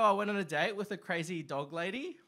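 normal talking voice

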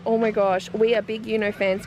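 ordinary talk

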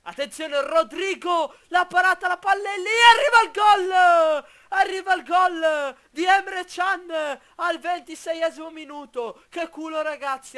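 loud, high excited goal call; breathless italian goal commentary. Acted. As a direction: shout the goal like a live commentator. Live goal call in game.

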